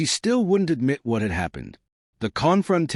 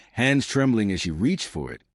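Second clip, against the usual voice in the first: ordinary delivery, nothing marked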